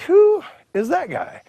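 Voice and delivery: high, drawn-out question; retold bewilderment at a stranger; incredulous. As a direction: stretch the opening word high.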